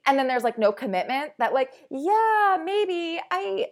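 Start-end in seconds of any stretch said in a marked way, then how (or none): 1.9-3.7 high, vague mock hedging; noncommittal dodge. Imitating someone stringing a friend along, noncommittal. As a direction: brush it off in a put-on high voice. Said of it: "the passive aggressive".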